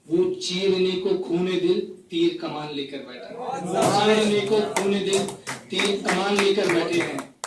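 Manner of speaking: phrase-by-phrase mushaira delivery; poetry recitation. Acted. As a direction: recite each couplet to the audience with pauses. Recites a poem to audience. (मुशायरे के अंदाज़ में कविता पाठ)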